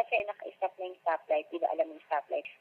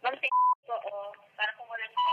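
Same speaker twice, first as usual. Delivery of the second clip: high, mouth stuffed with cotton; muffled crying voice; 3 semitones higher, 4 dB louder than usual. Pretend. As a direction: sob through a mouthful of cotton. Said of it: "punong-puno ng bulak ang bibig".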